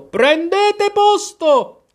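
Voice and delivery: very high, loud, drawn-out command; thundering shout. A narrator's reading. Queen orders players to their places, thundering. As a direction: shriek it across the whole field. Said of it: "tuonò la regina".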